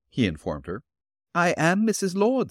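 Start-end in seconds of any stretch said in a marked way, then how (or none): 1.3-2.5 put-on higher, gentle old woman's voice; elderly landlady voice. Landlady answering the detective at her door, politely.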